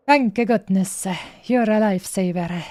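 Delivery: ordinary delivery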